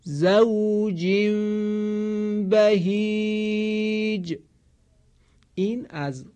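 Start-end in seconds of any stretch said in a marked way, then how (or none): 0.0-4.4 slow, drawn-out recitation tone; slow Quran recitation. Shown as a demonstration. Teacher reciting practice examples for students to repeat. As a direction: chant each example slowly, holding the nasal sounds.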